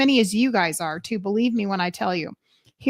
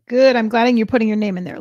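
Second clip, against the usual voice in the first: ordinary talk, nothing marked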